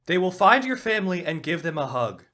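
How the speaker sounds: loud sing-song cheerful retort; mock cheery. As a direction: spin the threat into a joke. Host twists the threat sweetly.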